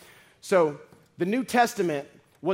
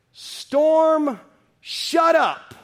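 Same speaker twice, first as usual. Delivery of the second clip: loud, high stern command to the storm; commanding Jesus; 12 semitones higher, 10 dB louder, much slower than usual. Pretend. Preacher voicing Jesus rebuking the storm, loud command. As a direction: bark it at the storm with full authority.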